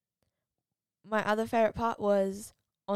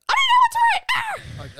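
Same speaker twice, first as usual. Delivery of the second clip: high, screaming imitation of panic; mock freak-out; 10 dB louder than usual. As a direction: yell it like a hammed-up meltdown. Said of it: "I had a bit of a freak out".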